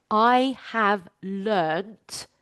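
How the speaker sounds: "I have learnt" very slowly, each word leaned on; slow emphasis. Shown as a demonstration. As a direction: stretch out "I have learnt" for weight. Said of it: "I'm gonna say that again".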